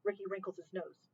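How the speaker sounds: ordinary delivery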